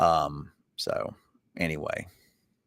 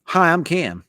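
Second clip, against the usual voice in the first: put-on lifted high boy voice, a bit louder; 8 semitones higher, 5 dB louder, slower than usual. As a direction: pitch it up like a young boy. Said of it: "if you do it in his boy- voice".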